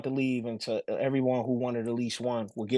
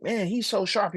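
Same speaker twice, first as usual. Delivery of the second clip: higher-pitched impressed remark; imitated admirers; 8 semitones higher than usual. People hearing his son talk, imitated admiration. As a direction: gush lightly like an amazed onlooker.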